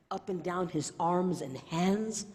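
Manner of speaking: ordinary talk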